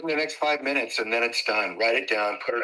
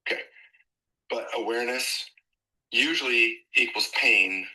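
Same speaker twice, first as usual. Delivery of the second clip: usual speaking voice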